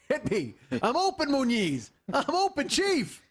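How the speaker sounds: very high, drawn-out playful call; mock calling out. Joking to co-host about shooting hoops, mock high calling out.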